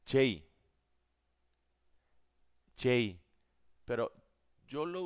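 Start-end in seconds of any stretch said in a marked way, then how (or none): 0.1-3.2 J said as the English letter, jay; name the J the English way. Shown as a demonstration. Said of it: "J en inglés".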